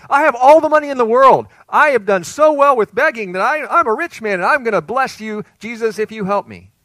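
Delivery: loud, high bragging boast; boastful. Acted. Preacher mocking a rich man bargaining with Jesus, loud and boastful. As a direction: puff up and brag loudly to buy a favor.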